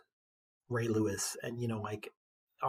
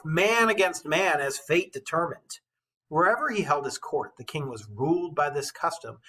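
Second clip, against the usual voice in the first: put-on measured storytelling read of an old epic; steady, rhythmic verse narration; poem reading; 5 semitones higher, 5 dB louder than usual. A narrator's reading. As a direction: read it like a bard to the class. Teacher reads epic poem aloud.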